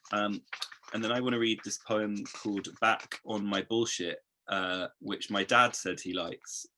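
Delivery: ordinary talk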